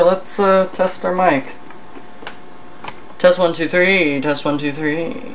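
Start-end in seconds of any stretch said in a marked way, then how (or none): none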